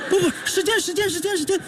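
high-pitched, stuck-record repetition; electric stutter. Acted. Comic telling jolted by static, electric stutter. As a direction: stammer the word as if electrified. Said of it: "你这有电是怎么着".

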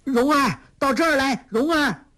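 put-on high calling out to a girl; a boy calling his hidden friend out of the bushes. A narrator's reading. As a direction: raise your voice and call her over. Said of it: "提高声音叫道".

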